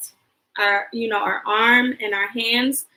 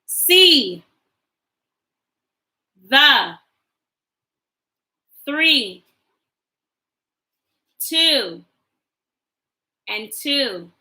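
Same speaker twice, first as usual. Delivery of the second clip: put-on slow, clear model reading for kids to echo; much slower than usual. Shown as a demonstration. Teacher reading sight words aloud for the class to repeat, slow and clear. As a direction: say each word alone, then pause. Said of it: "Repeat after me".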